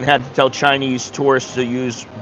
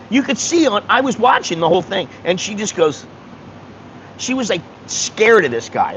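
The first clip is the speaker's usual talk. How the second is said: usual speaking voice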